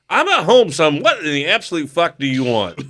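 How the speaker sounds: mock-outraged dad snapping at his son; exasperated snap. Dad answering his son's call, exasperated snap.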